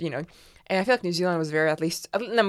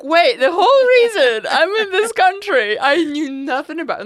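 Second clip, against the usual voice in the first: mock outraged disbelief; 11 semitones higher, 11 dB louder than usual. Student learning why she emigrated, mock outraged disbelief. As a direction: wail it in comic disbelief. Acts out own disbelief at reason.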